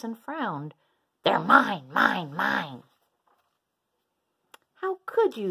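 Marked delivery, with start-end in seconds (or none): 1.2-2.8 loud, frowning claim; possessive shout. A narrator's reading. Minosaur grabbing a bunch of blocks, shouting crossly. 4.8-5.5 high, loud outraged cry; yelling. A narrator's reading. Iguanodon upset at the wrecked tower, yelling. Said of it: "yelled Iguanodon".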